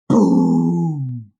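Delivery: boom sound cue. Shown as a demonstration. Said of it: "we don't do boom here".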